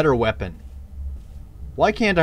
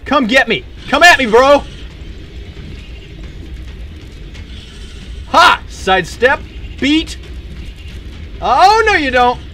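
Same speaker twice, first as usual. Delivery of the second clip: higher, louder cocky yelling; taunting shouts; 9 semitones higher, 4 dB louder than usual. Real, not acted. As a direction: goad the monster on. Taunting enemy mid-fight.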